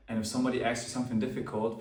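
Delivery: ordinary talk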